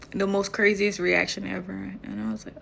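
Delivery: ordinary talk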